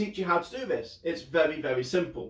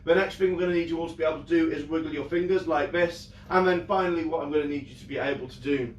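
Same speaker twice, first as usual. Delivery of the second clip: normal talking voice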